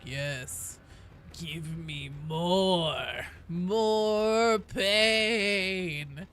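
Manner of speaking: slow, drawn-out hungry voice; mock a masochist begging, drawing out every word; pain-craving player. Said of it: "the other half of the player base that's like".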